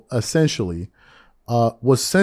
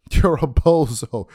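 slow, louder, laughing through the insult; 2 semitones higher, 6 dB louder, slower than usual. Real, not acted. Host laughing at Gilbert, slow and louder. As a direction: chuckle as you say it.

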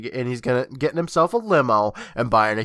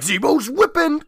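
goofy voice; 4 dB louder than usual. Retold or imitated. Acting David whipping sister.

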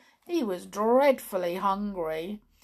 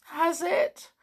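high, thin toy clown voice; Clockwork Clown voice; 10 semitones higher, 4 dB louder than usual. Acted. Clown answering Tiptoe from his bed, high toy voice. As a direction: play the sad little clockwork clown.

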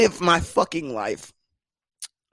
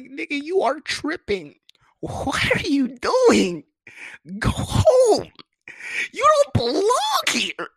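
high, drawn-out woman's voice telling him to leave; mock dismissive woman; 12 semitones higher, slower than usual. Woman sending the guy home, high mocking voice. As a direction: shoo him off in a shrill put-on voice.

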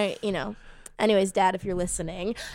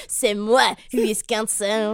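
laughing mock-French accent; put-on French accent; slower than usual. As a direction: play a comic Frenchman with a thick accent. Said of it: "You're doing a French accent".

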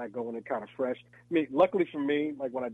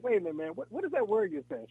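puzzled; 9 semitones higher than usual. Retold or imitated. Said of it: "finally I was like".

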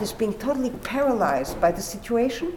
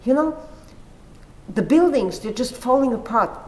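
alarmed; 4 semitones higher, 4 dB louder than usual. Retold or imitated. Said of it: "he says".

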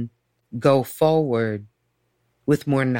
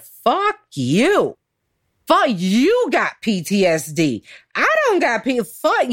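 livid outburst; 8 semitones higher, slower than usual. Retold or imitated. Client storming out on therapist, retold livid outburst. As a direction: spit back the diagnosis in high, swooping indignation. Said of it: "seriously like that".

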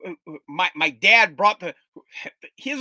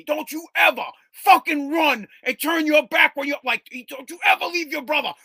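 loud high yelling father; furious dad; 9 semitones higher, 6 dB louder than usual. Retold or imitated. Father unloading on his son, loud furious yell. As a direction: tear into the kid for abandoning his brother. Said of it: "he unloads on me".

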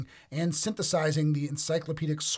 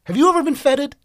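mock grand; 12 semitones higher, 14 dB louder than usual. Teasing about the word feted.

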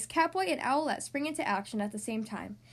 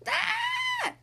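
high startled scream; 4 dB louder than usual. Acted. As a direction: shriek in fright. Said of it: "Amaya screams".

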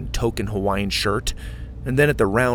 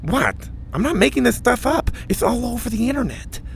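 put-on higher lisping kid voice; Mac's voice; 13 semitones higher than usual. A narrator's reading. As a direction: keep Mac's lisp going.

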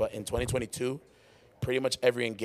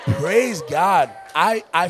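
loud, high praise; excited; 6 semitones higher, 9 dB louder, much slower than usual. Real, not acted. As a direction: call it out loud. Praising the venue.

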